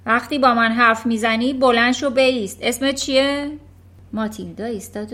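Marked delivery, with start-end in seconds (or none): 0.0-3.5 louder, harsh commands. Acted. Headmistress ordering Matilda to stand, harsh and loud. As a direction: order her to stand, roughly. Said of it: "با خشونت گفت".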